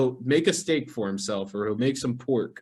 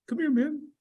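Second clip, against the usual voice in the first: wheedling roommate; 13 semitones higher, faster than usual. Retold or imitated. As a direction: pester him sweetly through a laugh. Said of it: "And he'd be like".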